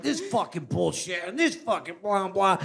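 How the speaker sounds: normal talking voice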